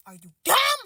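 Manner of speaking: high rising question; scornful, high-pitched challenge; incredulous insult. Acted. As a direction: jump up in pitch on disbelief. Incredulous at the texter.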